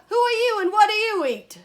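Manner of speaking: very high, very loud question; full-out storybook shout. Acted. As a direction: bellow the question like a brash frog. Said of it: "shouted the wide-mouthed frog".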